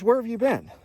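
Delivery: high, loud, pressing demand; urgent question. Retold or imitated. Duty officer demands to know where the airman has been, high urgent. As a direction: push hard, he needs an answer now.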